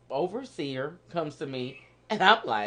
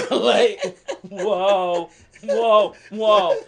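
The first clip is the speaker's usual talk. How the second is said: laughing whoa; 4 semitones higher, 9 dB louder, much slower than usual. Retold or imitated. Reacting to the crowd's greeting.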